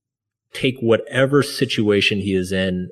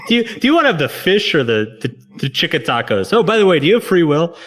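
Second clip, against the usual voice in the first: mock casual question; 9 semitones higher, 4 dB louder than usual. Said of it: "it's like".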